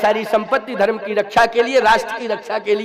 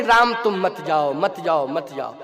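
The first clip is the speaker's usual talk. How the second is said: imitated attached father's plea; 6 semitones lower than usual. Old king begging Ram to stay, fading attached plea. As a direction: repeat it, fading away on each plea. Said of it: "मोह में आकर के बार बार कह रहे हैं".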